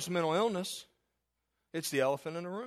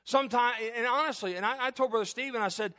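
higher, louder preacher's cry; full-force preaching; 5 semitones higher, 4 dB louder than usual. Acted. Pastor urging congregation to get help, preaching loud. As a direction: drive it home from the pulpit.

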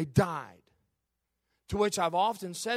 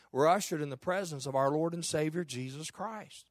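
usual speaking voice

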